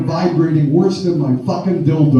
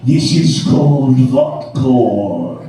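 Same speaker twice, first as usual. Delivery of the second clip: imitating [name]; 3 semitones lower, slower than usual. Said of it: "and he goes".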